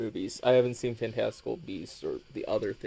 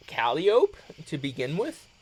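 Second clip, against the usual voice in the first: usual speaking voice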